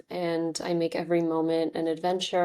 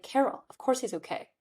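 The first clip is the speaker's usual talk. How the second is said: exasperated retort; 5 semitones higher than usual. Retold or imitated. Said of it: "I'm like".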